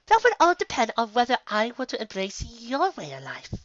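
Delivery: lightly voiced scheming unicorn; sly lady voice. A narrator's reading. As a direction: lean in and tease. Rarity's voice, sly.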